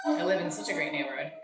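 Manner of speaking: dry sarcastic aside. Acted. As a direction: say it sarcastically, deadpan and fast. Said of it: "just kidding".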